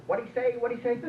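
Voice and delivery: high-pitched, querulous repeated question; hard-of-hearing wife. Acted. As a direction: pipe up as a wife who can't hear. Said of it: "The wife's going".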